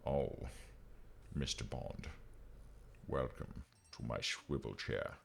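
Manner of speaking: low, quiet Scottish-accented spy-film impression; Sean Connery. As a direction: drop low and soft, slurring the s sounds into sh. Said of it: "did that in a Sean Connery accent".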